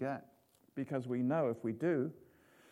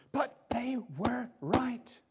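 slow whisper; slow confiding whisper; whispered aside; 8 semitones higher, 6 dB louder, slower than usual. Real, not acted. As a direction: lean in and whisper it slowly.

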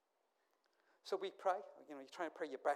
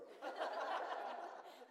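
airy unvoiced laugh; breathy laugh; 4 dB quieter than usual. Real, not acted. Preacher laughing at his own story. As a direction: let a soft laugh out.

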